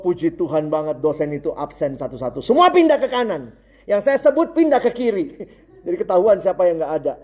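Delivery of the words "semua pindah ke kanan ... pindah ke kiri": high, raised classroom command; lecturer's orders. Retold or imitated. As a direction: call out instructions as an imitated lecturer.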